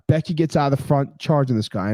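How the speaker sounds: usual speaking voice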